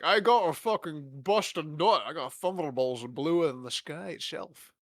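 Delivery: slow, lightly voiced Scottish Connery drawl; a loose imitation of Connery's Scottish lilt; half-hearted Connery Scots accent; Sean Connery impression. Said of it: "Of Sean Connery just being like".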